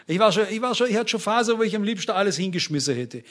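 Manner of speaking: ordinary talk, nothing marked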